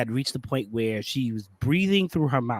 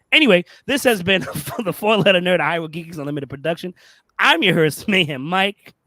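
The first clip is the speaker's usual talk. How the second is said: loud, raised host announcer voice; show sign-off announcer; 6 semitones higher, 8 dB louder than usual. Real, not acted. Host closing the show for viewers, loud announcer sign-off. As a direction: belt out the show's outro with punch.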